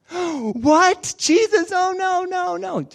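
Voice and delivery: gasping, high-pitched dismay; mock shock. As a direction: act out aghast disciples protesting. Shocked reaction to Jesus staying.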